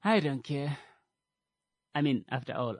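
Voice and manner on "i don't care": dismissive shrug. Shown as a demonstration. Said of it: "Don't just say".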